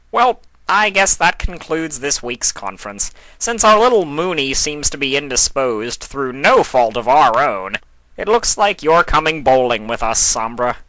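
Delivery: a touch of Discord's showman glee; cheerful chaos god. A narrator's reading. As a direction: wrap it up with showy glee. Discord cheerfully wraps up meeting.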